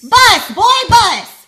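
shouted, high and loud; loud correction. Acted. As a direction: bark the word out at him. Mom correcting loudly.

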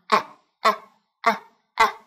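repeated flat a drill; English a. Shown as a demonstration. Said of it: "mà chúng ta sẽ đọc là".

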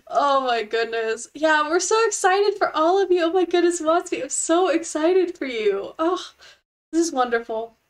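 ordinary delivery

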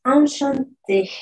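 enchanté said slowly, nasal an, stress on the final té; French enchanté. Shown as a demonstration. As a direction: model enchanté for the class, slow and clear. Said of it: "si queremos decir un gusto, decimos".

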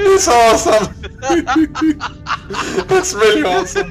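high, loud, laughing through the words. Real, not acted. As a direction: giggle while praising.

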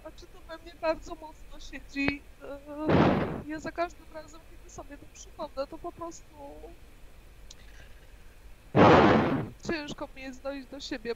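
barely audible, drained after tears; very quiet. Real, not acted. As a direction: speak almost without voice.

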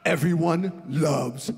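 slow, drawn-out sly Mooney voice; Mooney's slow jab. Retold or imitated. Mooney mocking Spoon's act backstage, slow deadpan drawl. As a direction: stretch every word like a deadpan insult. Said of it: "he says".

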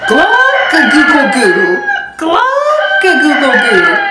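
slow, loud glocka-google-goo crow; silly rooster crow. Acted. Rudy showing his new crow to the kids, slow and loud. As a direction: draw the crow out like a proud young rooster. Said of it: "This time Rudy goes".

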